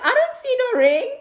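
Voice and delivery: high, mock-skeptical jab; teasing disbelief. Checking his hand for a ring, high teasing disbelief. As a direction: tease him, pitch shooting up. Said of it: "I'm like".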